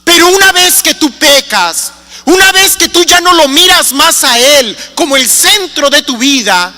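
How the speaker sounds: normal talking voice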